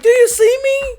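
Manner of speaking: high, loud needy call; mock plaintive call. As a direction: call back, begging for attention. Departing person calling back.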